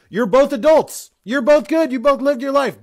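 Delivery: loud, high-pitched insistence; exasperated, emphatic and high; worked up. Real, not acted. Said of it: "I don't know why I'm getting angry".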